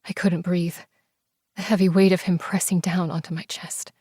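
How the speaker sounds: ordinary delivery, nothing marked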